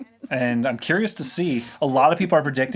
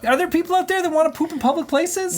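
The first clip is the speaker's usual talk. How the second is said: incredulous; 13 semitones higher than usual. Real, not acted. Incredulous about pooping in public.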